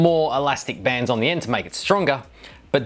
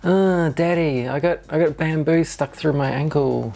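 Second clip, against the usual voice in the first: a hint of a kid nagging Daddy; mock child whine; 3 semitones higher than usual. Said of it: "kids nagging".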